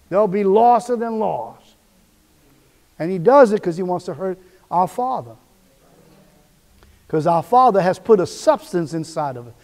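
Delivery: normal talking voice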